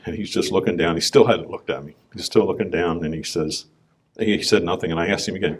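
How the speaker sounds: usual speaking voice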